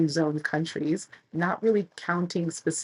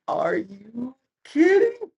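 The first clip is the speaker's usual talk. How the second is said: slow, high, louder disbelief; incredulous; 6 semitones higher, 4 dB louder, much slower than usual. Retold or imitated. Traveler reacting to the officers' joke, slow incredulous cry. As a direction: stretch out the disbelief.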